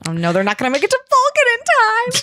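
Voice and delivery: playful panic climbing high at the end; mock dismay. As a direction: wail it in mock alarm. Listener playfully despairs at plot twist.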